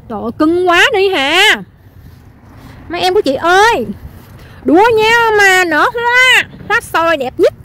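high, loud, drawn-out doting cry; hyped-up cooing. Real, not acted. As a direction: fawn loudly over your darling blossoms. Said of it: "Em [name] bữa nay tự nhiên sung quá". (hào hứng nựng nịu, giọng cao kéo dài)

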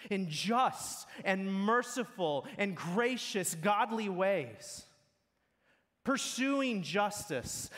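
rising pulpit exhortation, words slowed on godly ways; fervent preaching. Acted. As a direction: press the charge home to the congregation. Exhortation builds to full preaching.

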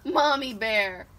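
ordinary delivery, nothing marked

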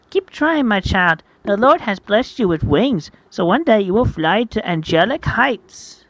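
high, lilting pious elder; encouraging nun. Acted. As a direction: preach sweet encouragement to a child, high and loud.

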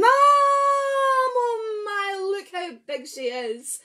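high loud gasp of awe; amazed. Acted. Reader amazed at Charlie's big mum, high awe. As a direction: marvel at the picture.

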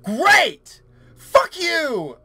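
loud, high exasperated outburst; angry shout. Real, not acted. Player interrupted during the voiceover, angry exasperated shout.

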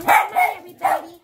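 loud cheerful call to join; rallying call. Pretend. Calling everybody in loudly before the song. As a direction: rouse everyone to sing along. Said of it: "I'll sing you a little song".

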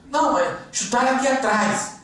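very high mock yell; high-pitched acted complaint; retold pained yell. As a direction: insist at a high pitch, imitating the hurt player. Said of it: "Ele gritou, ele falou assim".

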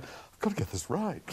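lightly voiced mock determination; imitating himself. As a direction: act out his own fixation. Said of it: "And I was going".

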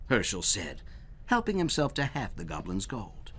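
usual speaking voice

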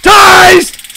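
loud, high whoop; triumphant yell. Pretend. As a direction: shout it as the taser hits. Shouting as he tases the suspect.

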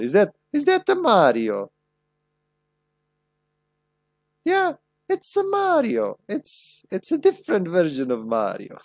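squeaky high Mario voice, slowed; a cartoon Mario impression. Streamer answering chat in a silly Mario voice. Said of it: "Don't make me do my Italian accent".

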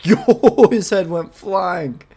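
loud, high whoop of amazement; thrilled shock. Real, not acted. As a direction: burst out laughing-loud, stretching the end. Gory kill in the game.

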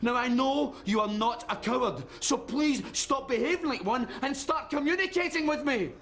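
angry scolding. Acted. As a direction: lecture your son, pitch climbing as exasperation builds. Father scolds his son.

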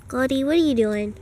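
ordinary talk

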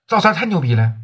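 usual speaking voice

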